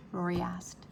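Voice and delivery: usual speaking voice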